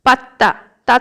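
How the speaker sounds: tense double t in পাত্তা; doubled ত. Shown as a demonstration. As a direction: hold the tt of পাত্তা, then release it. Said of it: "তয় তয় যেমন উচ্চারণ হয়". (পাত্তা-র জোড়া ত উচ্চারণ)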